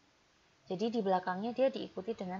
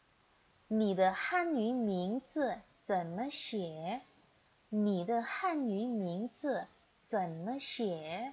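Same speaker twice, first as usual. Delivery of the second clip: slow model reading; much slower than usual. Shown as a demonstration. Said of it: "teacher models Mandarin sentences".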